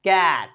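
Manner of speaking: "कैट" with a wide-open, drawn-out ऐ; "कैट" with the ऐ leaned on; accented ऐ vowel. Shown as a demonstration. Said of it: "accent लेकर पढ़ना है".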